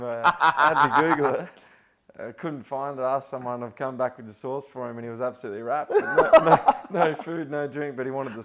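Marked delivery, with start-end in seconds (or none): 0.0-1.5 1 laughing. Real, not acted. Laughing while retelling the story. 5.9-8.0 high, loud laughing no no; squealing with laughter; laughing mimicry. Mimics homeless man refusing, laughing.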